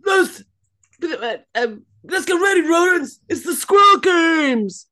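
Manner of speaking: loud, high Mad Max yell; imitating a shout. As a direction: bellow it like a warlord host. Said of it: "just gweiddi".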